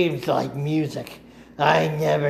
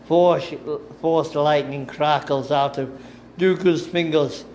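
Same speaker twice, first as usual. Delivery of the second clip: usual speaking voice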